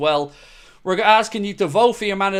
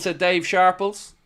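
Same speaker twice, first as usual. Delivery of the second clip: ordinary talk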